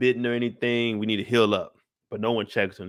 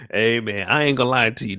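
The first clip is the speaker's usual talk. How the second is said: ordinary talk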